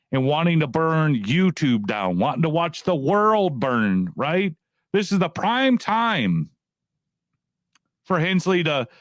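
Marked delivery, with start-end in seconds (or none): none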